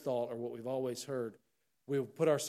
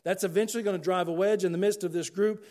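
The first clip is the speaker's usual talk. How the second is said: loud, high fiery preaching; preaching at full force; 6 semitones higher, 7 dB louder than usual. Acted. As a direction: thunder it from the pulpit.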